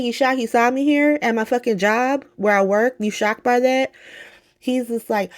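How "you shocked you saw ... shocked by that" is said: high-pitched, indignant comeback; retold incredulous retort; Willow. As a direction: throw the shock back in mock disbelief.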